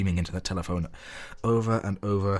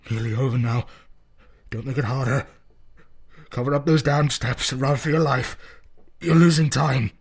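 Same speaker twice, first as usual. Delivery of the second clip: tense farewell; 6 semitones higher, 5 dB louder than usual. Acted. Warren's resigned farewell.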